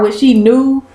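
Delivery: normal talking voice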